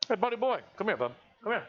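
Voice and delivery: high coaxing call to the dog. Real, not acted. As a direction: sing it up high to lure the dog over. Calling the shy dog over.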